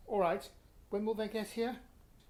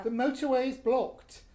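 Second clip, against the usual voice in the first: raised frustrated reply; 4 semitones higher, 8 dB louder than usual. Acted. Husband answers her loudly.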